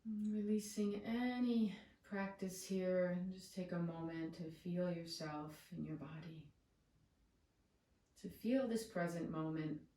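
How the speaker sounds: soothing, settled meditation guide; guided meditation. Real, not acted. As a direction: ease them into stillness, long pauses between phrases.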